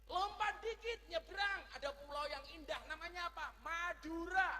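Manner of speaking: high, loud hype; excited announcing. Acted. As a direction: shout it like a tour host hyping the island. (bersemangat seperti pemandu wisata)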